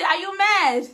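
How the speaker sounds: loud, high taunting Vera; hint of a loud taunting Vera; mock Vera taunt. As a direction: snap it like Vera. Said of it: "Vera go say".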